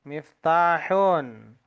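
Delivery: miftahun spoken slowly, every syllable clear; slow model word. Shown as a demonstration. Teacher models miftahun slowly for the class. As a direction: pronounce miftahun slowly for students to copy. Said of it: "Kunci bahasa Arabnya miftahun".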